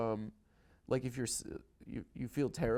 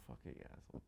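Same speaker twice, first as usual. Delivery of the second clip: whispered, much softer curse; under-breath insult; 20 dB quieter, faster than usual. Real, not acted. Cursing the host's ranking under his breath, whispered.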